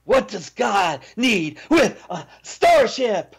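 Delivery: milk each word like Shatner; Shatner line reading. Pretend. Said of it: "Say the line".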